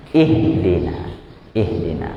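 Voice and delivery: "Ihdina" with a deep, slightly vibrating H. Shown as a demonstration. Teacher showing how the throat vibrates, H from deep inside. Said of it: "Kerasa dia agak getar".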